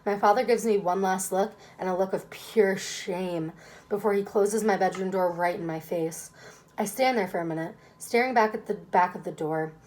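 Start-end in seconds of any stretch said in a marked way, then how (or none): none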